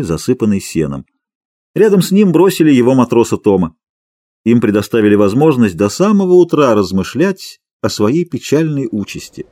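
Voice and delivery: ordinary delivery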